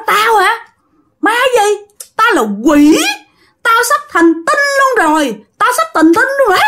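high-pitched boastful ghost howl; screeching demon. Acted. Possessed man tells the master it's a demon, screeching. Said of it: "bắt đầu gào lên".

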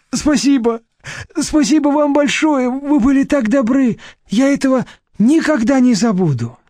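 high, fawning, grovelling thanks; humble muttering. A narrator's reading. Deputy director grovels with thanks, seeing Nadezhda out. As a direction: grovel with gratitude. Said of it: "забормотал униженным голосом".

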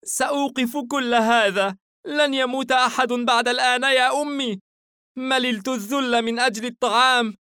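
put-on tearful, defiant boy, high and loud; Haim. A narrator's reading. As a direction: protest passionately like a child close to tears. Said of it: "حاييم بعينين دامعتين".

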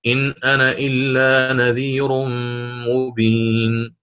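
slow chanted recitation; Qur'anic recitation. Shown as a demonstration. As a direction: recite it slowly, verse-style. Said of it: "سأقرأ". (ترتيل بطيء للآية)

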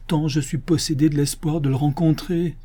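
plain reading voice

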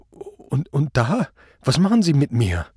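high, halting, frightened question; stammering. A narrator's reading. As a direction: stumble over the first words, voice pitched high. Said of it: "Er fragte stammelnd". (stammelnd, ängstlich)

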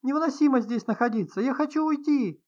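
high, loud hysterical wail; anguished moan. A narrator's reading. Writer begs to leave the study, wailing. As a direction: wail upward, drawing out the last plea. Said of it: "простонала Прасковья Фёдоровна".

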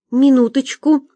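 plain reading voice

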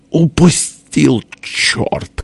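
vexed cry. A narrator's reading. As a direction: draw out the first word, spit the curse. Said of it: "крикнул тесть досады". (досадливый выкрик, протяжно)

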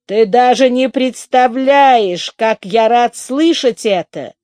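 joyful relief; overjoyed. A narrator's reading. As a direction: savour the good news. Delighted reaction to her news.

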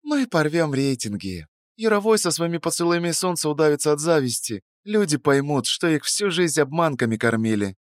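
dreamy but determined; young woman voice. Acted. Narrator voices Sveta.

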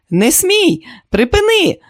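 very high, loud desperate command; screaming. A narrator's reading. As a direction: scream at an attacker to stop. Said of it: "закричала вона".

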